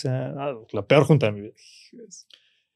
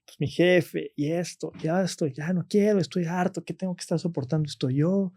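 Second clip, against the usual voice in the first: queja lenta y aguda de nieto harto; queja llorosa; 5 semitones higher, 4 dB quieter than usual. Retold or imitated. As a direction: quejarse despacio, a punto de llorar. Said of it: "Y yo". (nieto se queja con el abuelo, lloroso)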